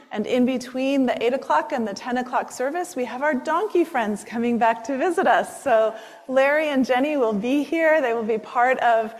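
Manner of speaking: ordinary talk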